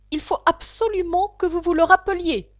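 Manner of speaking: high, loud royal demand; insistent king. A narrator's reading. The king demands that the hatter remember, insistently. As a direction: press the witness hard.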